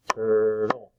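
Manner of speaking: "turtle" in two level, drawn-out beats; split into syllables. Shown as a demonstration. Teacher clapping syllables for children, "turtle" split in two. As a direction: stretch both parts of "turtle". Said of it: "clap out the different parts".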